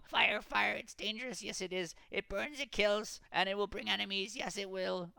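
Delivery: high, fearful creature voice; frightened Gollum voice. A narrator's reading. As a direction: warn of the fire in dread. Gollum warns about fire.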